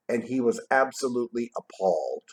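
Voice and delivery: ordinary delivery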